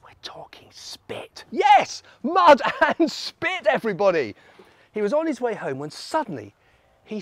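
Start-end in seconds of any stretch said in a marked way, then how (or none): 0.0-1.4 barely-there whisper; hushed reveal. Acted. Storyteller reveals more mess to the children, whispered. 1.5-4.3 loud, high burst of excitement; gleeful announcement. Acted. Storyteller announces the mess to the children, loudly excited.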